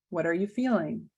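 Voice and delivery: ordinary talk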